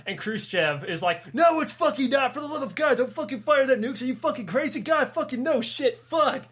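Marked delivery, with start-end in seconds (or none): 1.3-6.4 high, frantic mock panic over the radio; imitating a panicking leader, pitched up; freaking out. Khrushchev yelling over the radio at the sub, hammed-up panic. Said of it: "He's, like, freaking the fuck out".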